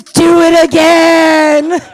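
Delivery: high, slow, drawn-out bellow; hammed-up roaring plea; shouted mock demand. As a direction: bellow it like an ecstatic lover. Boyfriend's demand, acted at full volume.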